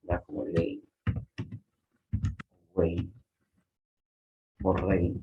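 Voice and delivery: "ley", "güey" and "rey" ending in an i sound. Shown as a demonstration. Teacher showing how Y sounds in "ley". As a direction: say "ley" with a plain i glide. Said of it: "esa Y suele pronunciarse como una I".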